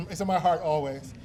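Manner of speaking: normal talking voice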